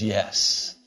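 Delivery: low, calm single word from God; God's quiet low yes. Acted. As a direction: answer simply and deep, like God speaking. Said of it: "And he says".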